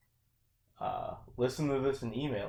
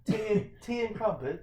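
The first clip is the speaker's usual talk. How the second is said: light mock British accent, high; a hint of posh British; 9 semitones higher than usual. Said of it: "everything sounds better in London".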